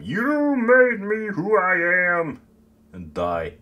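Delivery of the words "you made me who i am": high, loud, slow yell, stretching every word; drawn-out mock yell. Garrus blaming the parents for who they became, drawn-out high yell. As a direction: yell it like an overblown accusation, long held notes. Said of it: "be like Garrus and just yell at them".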